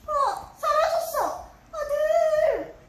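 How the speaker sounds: high, loud desperate calls; calling out frantically. Pretend. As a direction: cry out for the lost son. Son vanished, calling him.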